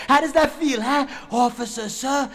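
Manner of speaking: high, loud gloating challenge; taunting shout. Acted. Turning gun on the cop.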